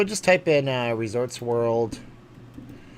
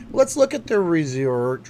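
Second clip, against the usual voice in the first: slow, louder host read; announcer voice; 4 semitones higher, 5 dB louder, slower than usual. Acted. Streamer announcing the review lookup, slow host voice. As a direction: stretch it out like a game-show host.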